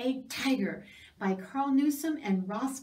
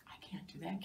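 soft, unvoiced admission; whisper; 5 semitones lower, 14 dB quieter, faster than usual. Mouse whispering that it can't hang from a branch. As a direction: whisper it, a bit embarrassed.

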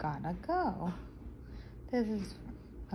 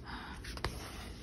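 sharp gasp; 13 dB quieter than usual. Acted. Child remembers she forgot something.

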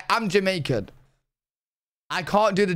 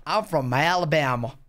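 Alabama accent, lower pitched; American accent; 6 semitones lower than usual. Shown as a demonstration. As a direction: have a go at a Southern US accent. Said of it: "Do your best American accent".

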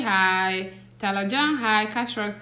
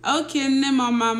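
ordinary talk

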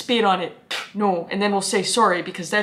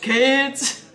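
put-on Texas accent; 4 semitones higher than usual. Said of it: "an actual Texas accent".

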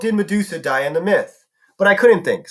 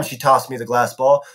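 ordinary delivery, nothing marked

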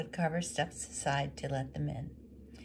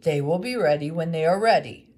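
put-on much louder, stubborn old man; gruff woodcarver; 13 dB louder than usual. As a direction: refuse to be rushed. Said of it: "he said".

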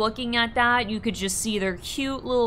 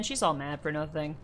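usual speaking voice

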